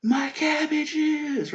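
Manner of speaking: high, drawn-out mournful cry of my cabbages; cabbage merchant wail. Retold or imitated. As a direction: wail it like the ruined cabbage seller. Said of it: "'cause they built up to the".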